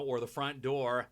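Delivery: ordinary delivery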